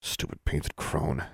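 slow, soft muttering under her breath; muttered. A narrator's reading. Alexandra muttering as she brushes off dust. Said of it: "Alexandra muttered".

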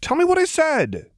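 high, insistent demand; flustered demand. A narrator's reading. Lux demands to know her mistake, high and flustered. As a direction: plead for the answer, mortified.

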